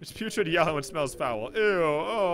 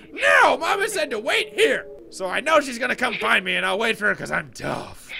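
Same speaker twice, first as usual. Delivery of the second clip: put-on high, loud little-boy outburst; stubborn child; 7 semitones higher, 6 dB louder than usual. Fluffy insisting Mama said wait here, stubborn child voice. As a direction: protest like an insistent kid.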